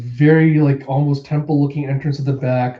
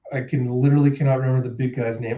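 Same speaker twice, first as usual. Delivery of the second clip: ordinary delivery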